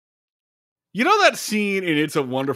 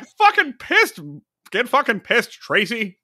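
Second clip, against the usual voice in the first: over-the-top Jimmy Stewart; 7 semitones higher than usual. Retold or imitated. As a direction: overdo the folksy hero cussing. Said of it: "more Shoresy than anything".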